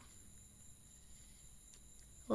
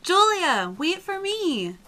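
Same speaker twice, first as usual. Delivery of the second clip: loud, higher call after a friend; 7 semitones higher, 13 dB louder, slower than usual. Acted. Girl shouting after Julia to wait, loud and high. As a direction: shout so she stops.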